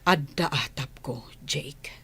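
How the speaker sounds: hushed confession; low secret whisper. Acted. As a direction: whisper the suspicion. Confessing a suspicion.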